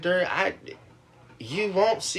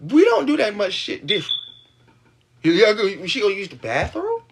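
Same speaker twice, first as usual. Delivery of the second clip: ordinary talk, nothing marked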